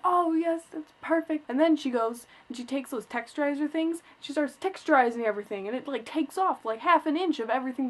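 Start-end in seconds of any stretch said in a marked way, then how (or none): 0.0-1.5 higher, louder mock glee; retold delight. Retelling her delight to the hairdresser, higher and louder. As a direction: gush like it's going great. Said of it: "I'm like".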